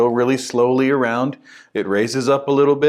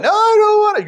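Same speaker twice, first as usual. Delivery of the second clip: put-on No, I don't wanna said in a high child's whine; whiny kid; 7 dB louder than usual. Kid refusing the ride.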